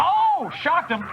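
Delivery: high, slow, gleeful gamer cry; excited. Real, not acted. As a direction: stretch it out with glee. Gameplay excitement.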